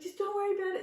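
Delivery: high, loud hurried calming; retold rush to reassure husband driving; urgent mock reassurance. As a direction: hurry to calm him, half laughing.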